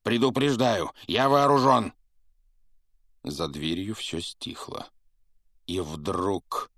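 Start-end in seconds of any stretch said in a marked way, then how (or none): 0.0-1.9 loud, high threatening shout; shouting a warning. A narrator's reading. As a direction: yell it, scared but defiant. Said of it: "крикнул дядя Вернон". (громкий крик с угрозой)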